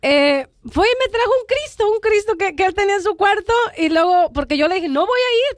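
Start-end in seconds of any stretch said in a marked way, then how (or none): 4.9-5.6 much higher, sharp annoyed no; to her brother-in-law, an irritated refusal; angry refusal. Retold or imitated. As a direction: snap the refusal back at him.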